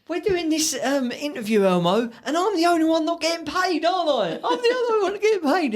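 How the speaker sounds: high squeaky mock Ricky Gervais voice; Ricky needling Elmo about pay, high and giggly; Ricky Gervais impression. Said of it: "And he goes, he goes".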